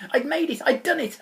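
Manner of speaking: usual speaking voice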